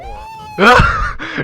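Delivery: high, squealing burst of laughter; cracking up at the screen. Real, not acted. Friends laughing at the video.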